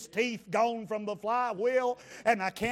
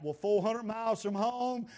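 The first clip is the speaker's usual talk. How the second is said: ordinary talk, nothing marked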